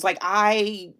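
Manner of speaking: normal talking voice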